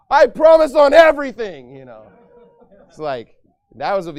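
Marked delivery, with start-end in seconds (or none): 0.1-1.8 high, loud mock vow; mock exclamation. Preacher imitating a loud teen's vow. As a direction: blurt the promise like a loud teen. Quoting Tyler Baker exclaiming.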